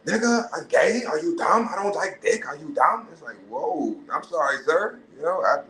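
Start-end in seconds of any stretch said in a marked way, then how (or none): 0.1-3.1 put-on offended tough girl. Tough girl snapping at catcaller. 3.5-5.6 flustered apology. Retold or imitated.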